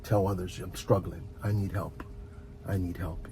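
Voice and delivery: normal talking voice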